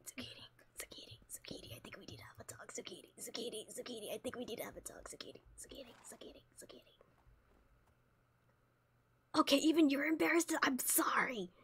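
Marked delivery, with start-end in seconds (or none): 0.1-6.9 hushed, breathy whisper calling the horse's name; softly whispered mock-stern talk. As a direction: whisper the scolding so nobody hears. Sneaking up to scold her horse.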